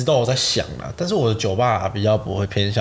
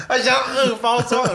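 laughing; 12 semitones higher than usual. Real, not acted. Laughing at friend's hidden burp.

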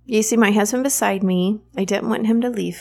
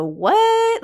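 mock high-pitched disbelief; incredulous what. People hearing about orgasmic birth, mock-incredulous high what. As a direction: imitate someone who can't believe what they just heard. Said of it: "most people go".

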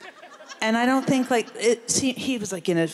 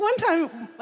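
high, giggling set-up line; laughing; 12 semitones higher than usual. Real, not acted. Friend laughing while starting a funny story, high. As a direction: laugh as you tee up the story.

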